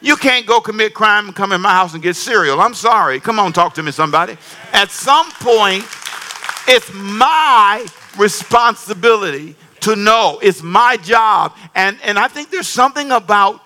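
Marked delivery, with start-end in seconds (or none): 4.7-11.5 slow emphatic. Real, not acted. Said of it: "preaching emphasis".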